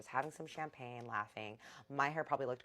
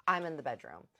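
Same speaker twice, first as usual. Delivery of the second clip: ordinary talk, nothing marked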